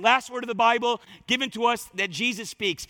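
ordinary delivery

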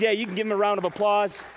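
ordinary talk

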